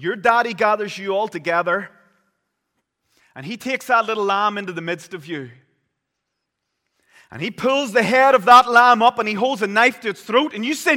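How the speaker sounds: loud, high, driving sermon voice; thundering pulpit preaching; preaching at full force. Acted. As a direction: preach it out to the congregation. Builds the Passover scene.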